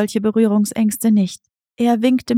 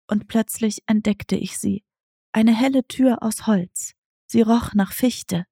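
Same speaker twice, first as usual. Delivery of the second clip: ordinary delivery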